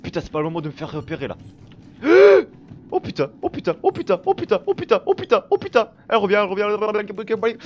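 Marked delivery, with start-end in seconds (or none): 1.4-2.9 loud, high gasp of shock; huge startled intake; horrified gasp. Real, not acted. Player just spotted, horrified gasp. 2.9-7.6 high, loud panicky repetition; panicking. Real, not acted. Player chased as the villain returns, panicking.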